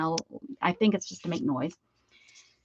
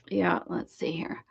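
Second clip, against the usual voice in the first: unvoiced muttered aside; whisper; 3 semitones lower, 5 dB quieter than usual. Real, not acted. Whispering to herself while turning the camera, hushed aside. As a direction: whisper to yourself while adjusting.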